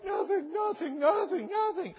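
high, whiny crying-child imitation; mock crying. Daughter insisting to her dad that nothing is wrong, mock crying. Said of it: "She was crying one time".

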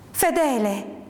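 restrained cry of alarm; frightened cry. Acted. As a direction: call the name out in mild fright. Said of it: "gridai spaventata". (grido spaventato, trattenuto)

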